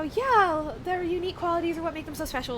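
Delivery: lightly voiced sweet Southern answer; mock polite parry. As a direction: breeze through it like a practiced Southern deflection. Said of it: "the way that you're supposed to".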